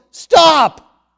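high, loud shout of protest; acted butcher shouting in protest; indignant yell. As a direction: yell out to stop him. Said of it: "And the butcher yells".

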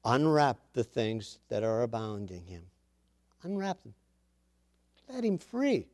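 soft, hushed, calm command; quiet and unhurried, with calm assurance; said so calmly. A narrator's reading. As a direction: give the order gently, well below preaching volume. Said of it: "he said it so calmly".

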